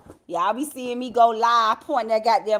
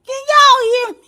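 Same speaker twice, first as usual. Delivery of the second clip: much higher and louder, calling out to the chat; 13 semitones higher, 13 dB louder than usual. Real, not acted. Host calling to live viewers whether they can hear her, loud call-out.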